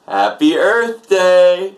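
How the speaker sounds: high, loud drawn-out holiday greeting; festive cheer. Real, not acted. Host cheering the holiday to co-host and viewers, festive. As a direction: stretch it out into a big celebratory call.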